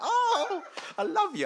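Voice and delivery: high, laughing squeal of delight; mock gushing. Imagined person gets a hundred pounds, squealing with laughter. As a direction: gush like someone handed a hundred pounds.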